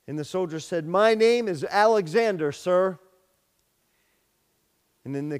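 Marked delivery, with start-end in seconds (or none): 0.9-3.0 loud, high answer at attention; loud reply. Retold or imitated. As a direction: snap back the answer loudly. Soldier answers the general loudly.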